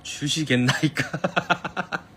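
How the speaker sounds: slow mock royal-court politeness through a laugh; hammed-up archaic "주시겠나이까"; archaic courtly speech. Shown as a demonstration. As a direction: ask like a servant in a period drama. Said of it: "너무 높인 느낌이라고?".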